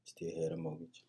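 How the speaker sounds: breathless whispered repeat, dropped right down; hushed unvoiced echo. Real, not acted. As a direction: echo it back in a whisper, almost to yourself. Wife's point echoed under breath.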